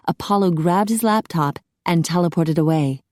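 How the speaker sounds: usual speaking voice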